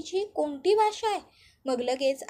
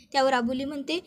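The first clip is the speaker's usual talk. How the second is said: ordinary delivery, nothing marked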